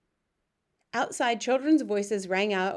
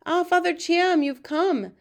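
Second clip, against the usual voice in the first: eager greeting; 9 semitones higher, 7 dB louder than usual. A narrator's reading. Headmistress greeting the monk.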